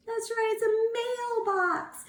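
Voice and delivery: high, bright praise; excited praise. Real, not acted. Teacher praising class, a high that's right. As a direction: lift it up to reward them. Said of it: "praising a class answer".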